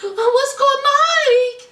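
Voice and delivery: high, wailing mama impression; hammed-up high, tearful-sounding plea; mock fretting mama. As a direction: imitate a flustered mother, half laughing. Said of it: "Mama Natalie was like".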